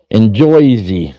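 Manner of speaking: loud mock Jersey accent, "Jersey" drawn out as "Joizy". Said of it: "did anybody have the accent?".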